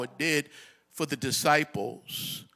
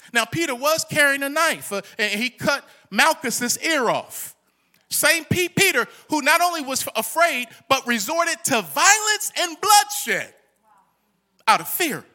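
raised, loud preacher's voice; ringing sermon delivery, higher and louder; full-force preaching; 11 semitones higher, 7 dB louder than usual. Real, not acted. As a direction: preach it out to the congregation.

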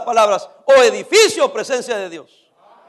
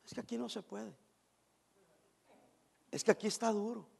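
a lightly voiced whining member, much softer; whiny complaining member; 18 dB quieter than usual. Pastor mimics a member grumbling about hardship. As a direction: mimic a complaining believer, soft and sulky.

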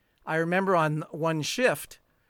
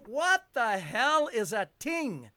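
dad's puzzled question; 6 semitones higher, slower than usual. Retold or imitated. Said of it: "I remember my dad saying".

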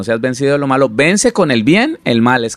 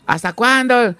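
higher, exasperated cry; exasperated plea; 11 semitones higher, slower than usual. Retold or imitated. Wife to her angry husband, exasperated and higher. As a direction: beg him to stop, fed up.

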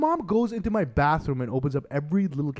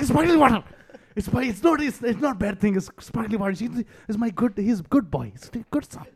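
higher, hurried mom voice; mom's panicky defense; 8 semitones higher, 3 dB louder than usual. Retold or imitated. Mother cutting in to defend her son, in a panicked higher voice. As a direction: jump in as a flustered mother, higher.

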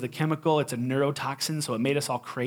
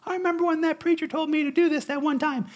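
imagined churchgoer; 13 semitones higher than usual. Pretend. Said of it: "you'll be like".

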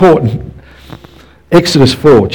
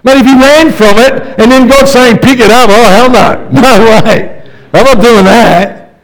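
high, loud incredulous voice; excited comic refusal; 9 semitones higher, 4 dB louder than usual. Acted. Preacher joking about Moses refusing God. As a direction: play up the disbelief for laughs.

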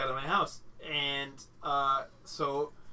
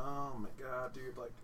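nervous whisper; 3 semitones lower, 11 dB quieter than usual. Retold or imitated. Reacting to smoking weed, nervous.

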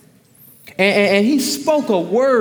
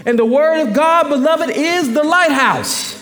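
full-force preaching; 6 semitones higher than usual. Acted. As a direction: preach it out to the congregation.

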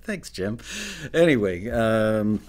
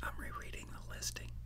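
whispered to himself under his breath; whispering; 17 dB quieter, faster than usual. Real, not acted. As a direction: quietly read it aloud to yourself. Muttering while rereading the listing.

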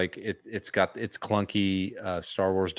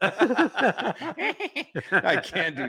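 laughing; 9 semitones higher, much slower than usual. Real, not acted. Laughing while joking.

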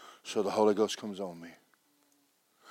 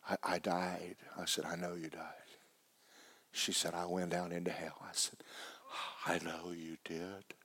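hushed retelling; 2 semitones lower, 14 dB quieter than usual. Retold or imitated. Hushed retold phone call.

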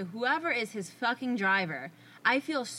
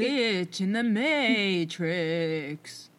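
very drawn-out, lower mock-dramatic delivery; hammed-up slow, low title reveal; fun spooky announcement; 4 semitones lower, 4 dB louder, much slower than usual. Shown as a demonstration. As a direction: stretch every word for comic drama. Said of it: "how to say that in a fun way".

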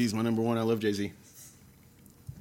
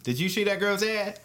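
mocking the girl; 6 semitones higher than usual. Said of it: "Or however she talks".

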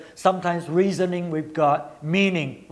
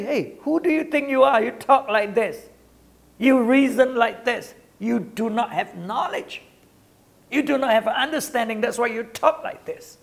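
raised, challenging voice of God rebuking a proud man; a touch higher, demanding and reproachful; God scolding Job; 6 semitones higher than usual. Retold or imitated. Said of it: "God say".

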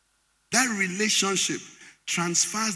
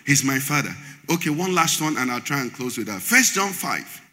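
ordinary talk, nothing marked

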